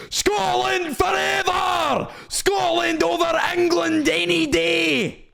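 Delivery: high, drawn-out rallying yell; mock patriotic war cry. Mimicking offended Scottish viewers in chat, mock war cry. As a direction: bellow it like a fanatical Scottish supporter. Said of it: "All I see is".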